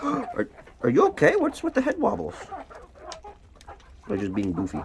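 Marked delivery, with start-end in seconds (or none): 0.0-2.4 pet talk. Acted. Worried at a hen.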